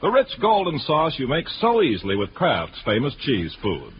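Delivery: ordinary talk, nothing marked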